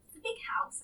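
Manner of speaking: mild defensive pushback. Acted. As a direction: stick up for dad, a touch defensive. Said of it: "feeling defensive of my father".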